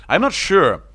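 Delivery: high, loud burst; excited. Real, not acted. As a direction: jump in eagerly. Excited commentary.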